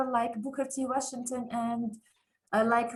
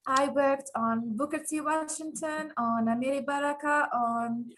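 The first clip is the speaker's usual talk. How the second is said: ordinary talk, nothing marked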